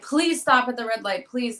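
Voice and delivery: high, desperate begging; retold plea at a red light. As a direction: plead with him to stop. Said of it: "literally begging him".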